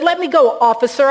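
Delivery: high, loud acted plea; alarmed protest. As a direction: protest loudly as if grabbed. Man protests being lifted by officer.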